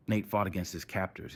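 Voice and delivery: plain reading voice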